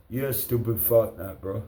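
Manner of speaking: normal talking voice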